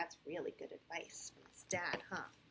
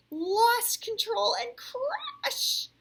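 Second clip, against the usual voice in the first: loud, high, drawn-out alarm; dramatic crash; 16 dB louder, much slower than usual. A narrator's reading. Narrator tells of Hudson's crash, dramatic alarm. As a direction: stretch it out as disaster strikes.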